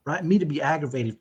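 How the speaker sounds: normal talking voice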